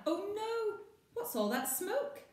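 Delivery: high, alarmed cry. Acted. Maggie spotting smoke from the camper van, alarmed cry. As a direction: panic at the smoke.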